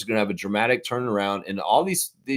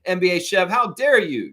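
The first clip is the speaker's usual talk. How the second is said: scolding; 9 semitones higher, 5 dB louder than usual. Real, not acted. Scolding Chev directly.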